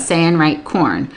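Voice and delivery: ordinary delivery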